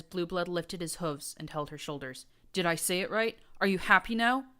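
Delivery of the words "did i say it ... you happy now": building anger. A narrator's reading. Prince voice building.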